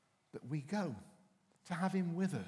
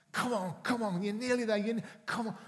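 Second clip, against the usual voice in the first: raised, higher coaxing call; urging on children; 6 semitones higher, 5 dB louder than usual. Retold or imitated. Father coaxing reluctant children up a mountain, loud encouraging call. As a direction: hurry the kids up the mountain, loud and high.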